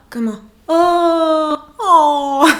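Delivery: "O" drawn out slow and loud, hammed up; wrong O shown. Shown as a demonstration. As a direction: exaggerate the wrong French "O". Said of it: "ce n'est pas un O".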